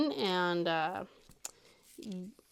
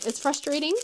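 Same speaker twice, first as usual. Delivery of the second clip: normal talking voice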